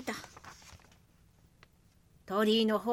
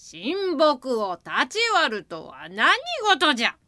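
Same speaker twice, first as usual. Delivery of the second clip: a hint of a heavy old god; solemn old god scolding, lightly voiced; creaky wise old man; 2 semitones higher, 6 dB louder than usual. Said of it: "重々しい声がした。". (重々しい老神の声)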